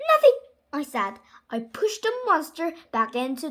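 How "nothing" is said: high, loud cover-up; hasty denial. Acted. Child hiding the monster from Mom, loud high blurt.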